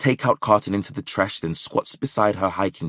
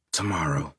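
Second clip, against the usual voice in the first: inner thought; 4 semitones lower, 6 dB quieter than usual. Acted. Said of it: "she thinks".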